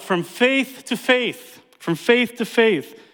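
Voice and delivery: slow emphatic. Acted. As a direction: spell the phrase out for the room.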